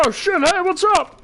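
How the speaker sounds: high, startled "oh shit, hey"; surprised greeting. Retold or imitated. Bumping into her in the domain, a startled greeting. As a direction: caught off guard, greeting her. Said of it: "I'm like".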